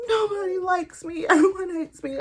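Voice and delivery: very high, loud whiny cry; self-pitying in labor, very high whiny wail; mock wail. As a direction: wail it out like a hurt child.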